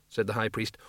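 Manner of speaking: plain reading voice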